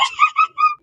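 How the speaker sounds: loud high delighted laugh; high giggle. Acted. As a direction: let out a squealing laugh. Daughter laughs at payday.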